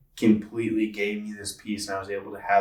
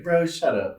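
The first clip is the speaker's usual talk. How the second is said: higher, angry snap at the texter; angry retort; 8 semitones higher than usual. Retold or imitated. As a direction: bite back at the text, fed up. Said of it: "I got mad".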